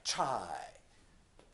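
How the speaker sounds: チャイ stretched slowly, lengthening the first part; 二重母音を長く伸ばして; drawn-out diphthong. Shown as a demonstration. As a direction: drag out the チャイ. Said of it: "前の方を長く".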